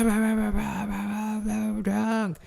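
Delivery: put-on high, slurry drunk babble, lightly done; drunk friend babbling. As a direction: mimic a babbling drunk buddy. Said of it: "he's like".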